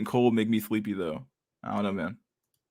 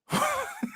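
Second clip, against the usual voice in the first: high-pitched laugh; 6 dB quieter than usual. Real, not acted. Laughs after skit.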